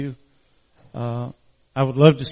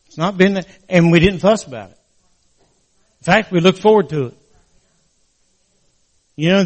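preaching; 3 semitones higher than usual. Acted. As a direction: lift it into a preaching plea. Pastor rising into preaching.

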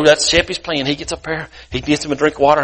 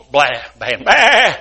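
bleating flock; 2 semitones lower, slower than usual. Acted. All the sheep Sheppy hears, bleating. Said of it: "he hears all the sheep".

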